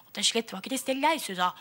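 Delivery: ordinary delivery, nothing marked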